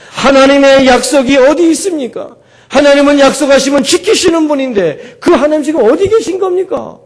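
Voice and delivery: a touch of a tearful wail, climbing to a high cry at the end; wailing prayer. Acted. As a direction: lament rising toward heaven. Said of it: "하고 통곡한 거예요".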